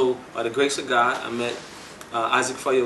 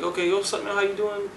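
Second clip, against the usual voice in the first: high, friendly greeting; eager greeting; 8 semitones higher than usual. Retold or imitated. Greeting the young rapper he just heard, high and eager. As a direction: greet him warmly and up.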